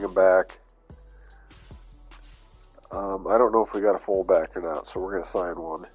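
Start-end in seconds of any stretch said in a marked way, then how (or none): none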